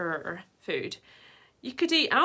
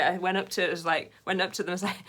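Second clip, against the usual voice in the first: normal talking voice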